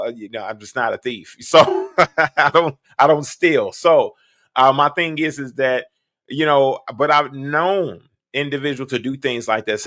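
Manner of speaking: ordinary delivery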